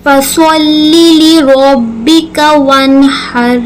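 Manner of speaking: slow melodic recitation; Quran recitation. Acted. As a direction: chant the verse slowly. Recites the verse. (धीमी तिलावत)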